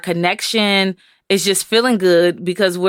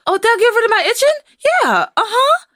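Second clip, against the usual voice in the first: put-on high, hurried little-kid voice; eager child. Child hoping a shower cures the itching, eager. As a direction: imitate a gullible, excited child.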